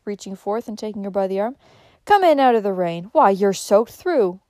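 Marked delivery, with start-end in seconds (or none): 2.0-4.4 loud, urgent call to come in from the rain. A narrator's reading. Old man calling out to a soaked stranger at the door, loud. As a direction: raise the voice to pull her inside. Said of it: "he cried".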